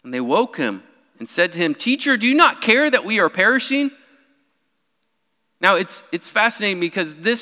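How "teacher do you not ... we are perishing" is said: higher, urgent cry of the disciples; panicked plea. Retold or imitated. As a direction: plead in alarm over the storm. Disciples wake Jesus in storm.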